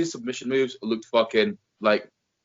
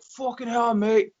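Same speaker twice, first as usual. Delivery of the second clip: mock British curse; 9 semitones higher, slower than usual. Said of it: "he's like".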